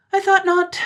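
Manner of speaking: put-on high, loud triumphant girl voice; high triumphant reply. A narrator's reading. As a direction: crow like a victorious girl. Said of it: "the girl said triumphantly".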